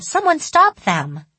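high, drawn-out plea; wailing child. A narrator's reading. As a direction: wail for help like a schoolkid. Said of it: "The other kids started wailing".

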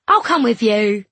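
loud, eager lad; eager boy. Acted. Boy offering to walk his friends home, eager. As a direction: pipe up loudly to join them.

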